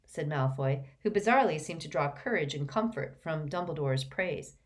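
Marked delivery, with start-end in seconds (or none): none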